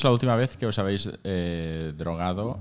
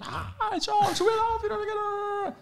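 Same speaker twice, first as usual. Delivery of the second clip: high, shrill-pitched excited fan shriek, much higher; fan screaming. Retold or imitated. Friends screaming love at Steve Jobs on the phone, high excited shriek. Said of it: "y ponemos a gritar todos".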